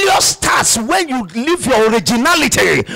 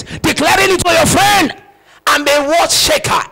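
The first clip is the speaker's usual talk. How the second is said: rising preaching shout; 5 semitones higher, 4 dB louder than usual. Real, not acted.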